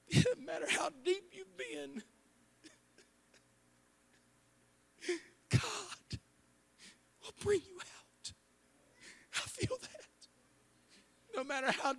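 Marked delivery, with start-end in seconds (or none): 0.1-9.9 tearful, choked-up preacher; crying. Real, not acted. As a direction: voice breaking high with tears. Preacher overcome mid-sermon.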